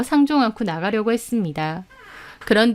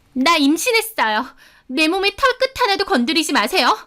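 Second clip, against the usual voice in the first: high-pitched, defiant outcry; crying out; 8 semitones higher than usual. A narrator's reading. Pregnant rival warding off attacker, crying out. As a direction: cry it out to fend her off. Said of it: "이슬비가 외치는".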